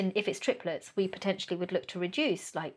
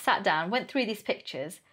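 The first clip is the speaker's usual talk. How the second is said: ordinary talk, nothing marked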